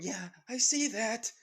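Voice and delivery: high, loud dry sarcasm; a touch of mocking agreement; sarcastic. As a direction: snark back at her. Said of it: "With Izuku saying".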